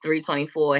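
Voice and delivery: ordinary delivery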